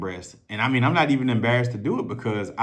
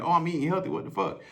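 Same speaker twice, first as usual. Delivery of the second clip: a touch higher, puzzled self; mock inner voice; 7 semitones higher, 3 dB quieter, faster than usual. Himself puzzled at the scale, mildly higher. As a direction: voice his own confused thought. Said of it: "in my head".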